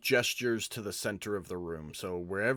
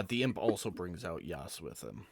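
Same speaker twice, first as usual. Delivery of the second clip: normal talking voice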